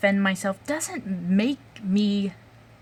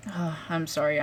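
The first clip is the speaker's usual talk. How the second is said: usual speaking voice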